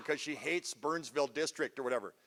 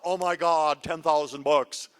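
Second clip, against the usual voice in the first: louder, slower lament; mock dwelling; 7 dB louder, slower than usual. Shown as a demonstration. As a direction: wallow over the mistake, half-joking.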